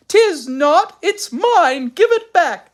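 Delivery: put-on high, loud childish retorts; squabbling children. A narrator's reading. Twins fighting over a sock, squabbling loudly.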